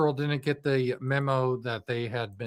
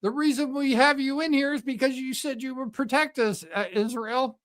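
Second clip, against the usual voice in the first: voiced much higher, indignant; 10 semitones higher than usual. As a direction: play the betrayed ally, pitched up.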